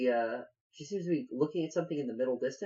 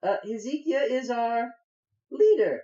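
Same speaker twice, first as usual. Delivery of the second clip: high, measured halfling voice; pleading halfling; 11 semitones higher, 3 dB louder than usual. Acted. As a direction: talk down an armed stranger, high-pitched. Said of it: "She says".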